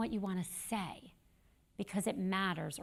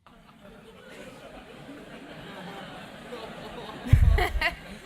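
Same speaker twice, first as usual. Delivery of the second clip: amused laugh; 4 semitones higher, 8 dB louder than usual. Real, not acted. Laughs at the Uber question.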